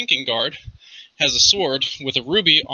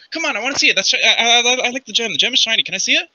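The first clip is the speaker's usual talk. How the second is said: put-on high, stammering childlike plea; eager little catfolk begging; pestering Chester; 8 semitones higher, faster than usual. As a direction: wheedle in a pitched-up cat-folk voice.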